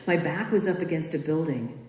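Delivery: plain reading voice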